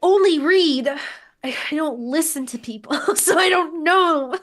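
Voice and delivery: high, loud, half-laughing protest; mock-defensive shriek at a critic; exasperated retort. Defending herself to an online critic, high laughing protest.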